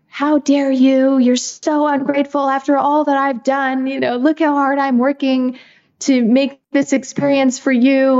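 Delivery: high-pitched, indignant mock tirade; voiced inner freak-out. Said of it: "is freaking out".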